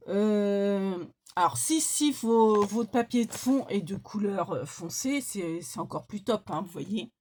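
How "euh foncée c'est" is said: normal talking voice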